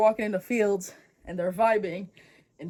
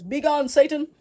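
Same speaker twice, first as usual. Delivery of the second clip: raised, theatrical banishing cry; mock exorcism shout; 6 semitones higher, 6 dB louder than usual. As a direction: play a hero casting out the devil. Acted son banishes a dog.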